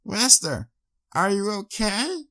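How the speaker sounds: put-on high girlish voice, gently anxious; worried young mare. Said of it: "she repeated once more".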